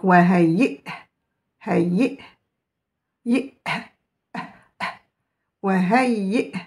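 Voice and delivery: "wahey" spelt out slowly, with the hamza's stop; "wahey", each syllable drawn out; slow teaching demo; slow recitation. Shown as a demonstration. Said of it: "ce qui m'intéresse pour prononcer".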